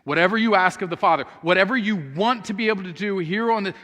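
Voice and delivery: ordinary talk, nothing marked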